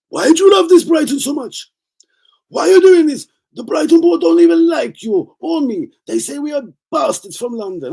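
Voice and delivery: very high, hammed-up pleading rant; mock-outraged high voice; exasperated put-on character. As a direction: scold in a squeaky put-on voice, loud at first. Acted high-voiced rant over Brighton buys.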